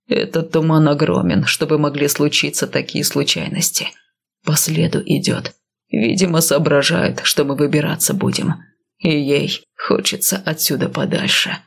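low, grim man's voice; gruff warrior, low and steady; stern northerner. Acted. Male lead voiced lower.

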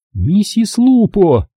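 a wife cutting her husband off firmly; curt retort. A narrator's reading. Claudia correcting her husband, snapping back. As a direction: snap it back high and slow. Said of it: "отрезала Клаудия".

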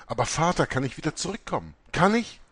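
pressing the question, rising high on the last Kann ich; emphatic pleading ask; insistent question. A narrator's reading. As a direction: urge him to answer, voice climbing. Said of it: "stellte die Frage mit Nachdruck".

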